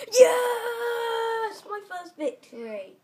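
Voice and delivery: huge high shout of triumph; celebrating. Real, not acted. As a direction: yell out your first win. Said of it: "I'm just celebrating".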